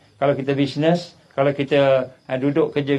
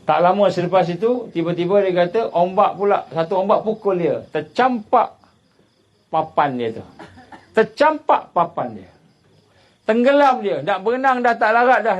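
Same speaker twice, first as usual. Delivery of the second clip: raised, driving sermon voice, building the drama; preaching at full force; 8 semitones higher, 4 dB louder than usual. Acted. Preacher to his congregation, telling of the tsunami, fired-up sermon delivery. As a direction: push the story like a fiery preacher.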